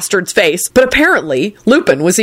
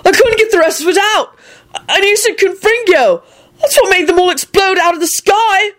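excited scream; 14 semitones higher than usual. A narrator's reading. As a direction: defend yourself, thrilled. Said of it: "Neville screamed back excitedly".

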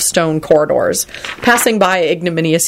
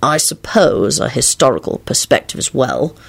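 measured musing, with pauses; thoughtful; 3 semitones lower than usual. A narrator's reading. Lupin working through his literary answer, thoughtfully. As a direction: think aloud, weighing each term. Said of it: "he replied thoughtfully".

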